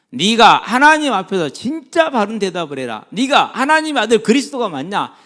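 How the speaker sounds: high-pitched, emphatic acted questioning; stern interrogation, acted from the pulpit; interrogating priest. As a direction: demand an answer as the accuser. Voices priests questioning Jesus.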